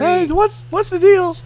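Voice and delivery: very high nasal-free comic stand-up voice; Jerry Seinfeld voice. Retold or imitated. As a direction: do Jerry's what's the deal. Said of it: "My name is Jerry".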